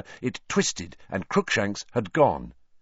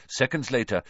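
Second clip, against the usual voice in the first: ordinary delivery, nothing marked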